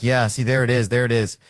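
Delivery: loud, pleased pounce; mock goading. Detective getting suspects to yell back, loudly goading.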